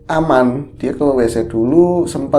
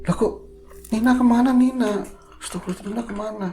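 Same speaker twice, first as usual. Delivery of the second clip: put-on high, rising alarm as she searches for the child; panicked mother; 10 semitones higher, 4 dB quieter, faster than usual. Mother realizing her child is gone, panicked. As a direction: call out for the missing girl, frightened.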